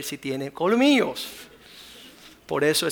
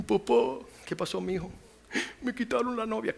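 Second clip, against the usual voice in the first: mock whiny son; 6 semitones higher, 3 dB quieter than usual. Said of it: "Se fue a la casa".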